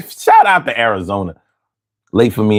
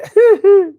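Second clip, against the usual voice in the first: high squeal of a laugh; 3 dB louder than usual. Real, not acted. Laughs at his own joke to chat.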